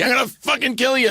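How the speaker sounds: high mock-angry threat in a New York accent; playfully menacing, pitched high; mock furious New York threat. Dorothy actress threatening the cast, mock rage. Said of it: "And this is Dorothy".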